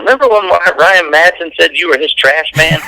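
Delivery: lightly imitated buddy, pitched high; ribbing beer buddy. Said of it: "they're sitting around having a beer going".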